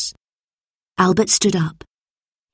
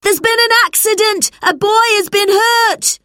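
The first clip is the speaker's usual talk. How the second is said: higher and louder, alarmed call; urgent shout; 5 dB louder than usual. A narrator's reading. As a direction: raise the alarm across the factory floor. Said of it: "he shouted".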